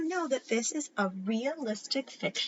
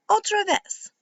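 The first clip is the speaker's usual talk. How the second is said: cheering; 11 semitones higher, 5 dB louder than usual. A narrator's reading. Cheering his sister on.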